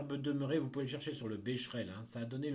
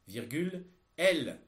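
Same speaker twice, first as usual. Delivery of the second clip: dictating; 3 semitones higher, 7 dB louder than usual. Shown as a demonstration. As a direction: read each word out for pupils to copy. Teacher dictating text to pupils.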